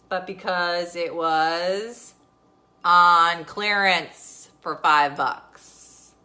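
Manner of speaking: slow, loud emphatic punchline; drawn-out punchline. Real, not acted. Mom mocking husband's cheap gift, slow and emphatic. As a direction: drag out every word for the joke.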